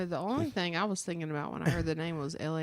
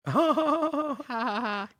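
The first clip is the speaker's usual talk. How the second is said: mock ha ha ha laugh, higher; fake laugh; 7 semitones higher, much slower than usual. Real, not acted. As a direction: spell out a fake ha ha ha. Laughs at own team name.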